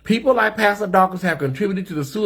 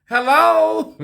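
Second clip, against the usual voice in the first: very high sing-song hello; 11 semitones higher, 3 dB louder than usual. Shown as a demonstration. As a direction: lilt the greeting up high. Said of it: "That's my voice".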